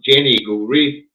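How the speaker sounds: normal talking voice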